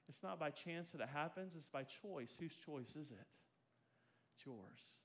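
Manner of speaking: soft, earnest appeal; quiet altar call. Acted. Preacher softly inviting the church to Jesus, earnest. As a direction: plead gently with the listeners.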